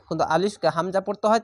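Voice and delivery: ordinary talk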